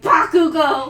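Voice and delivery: high, loud mock shout of a name; hammed-up furious call; accusing yell.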